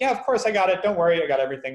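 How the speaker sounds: casual, upbeat brush-off; breezy reassurance. Retold or imitated. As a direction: wave it off cheerfully. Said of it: "He's like".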